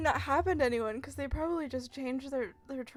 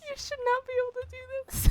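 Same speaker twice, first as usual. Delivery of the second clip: high, loud and fast through laughter; can't believe it, cracking up; laughing disbelief; 12 semitones higher, 8 dB louder, faster than usual. Real, not acted. Laughing disbelief at game glitch.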